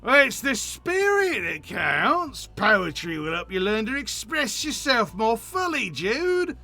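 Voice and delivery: lightly voiced pushy optimist; cheery friend. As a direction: coax a shut-in outdoors, hammed up. Same NPC continues.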